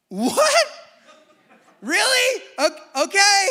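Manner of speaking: very high, loud, laughing disbelief; husband stunned by a fourth pregnancy. Retold or imitated. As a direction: squeak it out in shock.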